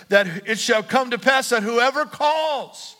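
loud, high, slow preaching build; full-force preaching. Acted. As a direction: preach it out with rising fire. Preaching the promise.

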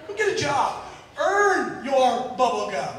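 slow, pointed "Go get a job"; pointed repeat. Shown as a demonstration. Preacher repeats his retort to the congregation, slow and pointed. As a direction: lean on each word, slowly.

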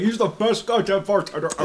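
high-pitched mock talking while eating; mouth full of pie. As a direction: garble it as if through pie. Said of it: "between mouthfuls of pie".

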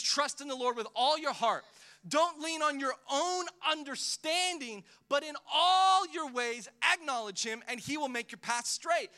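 loud, high, forceful reading of the verse; preached scripture. Shown as a demonstration. Pastor proclaiming the verse to the church, loud and forceful. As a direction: proclaim "Trust in the Lord" with full preaching power. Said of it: "And we teach it nicely".

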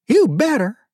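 high, loud playful warning; like a mother warning a child, in good humor; good-humored yet serious. A narrator's reading. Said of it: "in a good-humored yet serious tone".

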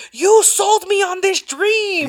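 slow, high mock-dramatic accusation; hammed-up reproach, stretched out and pitched high; imitated Pierre's reproach. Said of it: "And Pierre's like".

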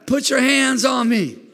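full-force pulpit preaching. Acted. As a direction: lift the room with it. Preaching climax, shouted.

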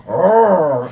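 flirty mock growl; a teasing little roar; playful roar.

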